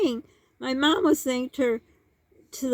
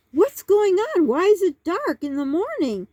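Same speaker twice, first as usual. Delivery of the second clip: bewildered mom; 6 semitones higher, 4 dB louder than usual. Retold or imitated. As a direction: imitate mom's higher-pitched, bewildered questioning. Mom calls friend, bewildered.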